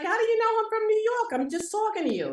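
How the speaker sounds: high, fast, baffled protest; mock indignant. New Yorker answering Southerners, mock indignant. Retold baffled reply to questioners.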